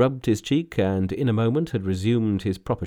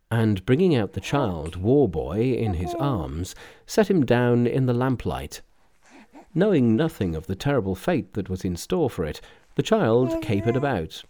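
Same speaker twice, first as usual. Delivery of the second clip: usual speaking voice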